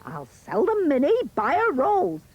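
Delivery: very high, excited mother yelling; loud yell. A narrator's reading. Mother spotting gold and ordering Jack up, loud yell. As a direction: yell orders at the boy, pitch soaring. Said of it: "She yells out loud".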